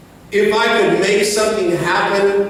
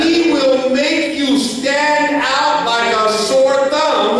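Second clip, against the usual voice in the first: rising preacher's cadence building to a shout; full-force preaching; 4 semitones higher than usual. Acted. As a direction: drive it home to the congregation.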